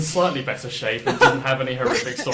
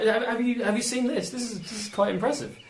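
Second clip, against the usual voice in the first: much higher, wondering character voice; curious Roar voice; 10 semitones higher than usual. Acted. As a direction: peer at the toy in a high curious voice. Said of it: "gives it to Shade and is like".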